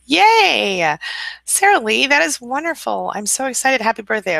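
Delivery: high, bright burst of joy; thrilled congratulations; excited cheering. Real, not acted. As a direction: squeal with delight for her.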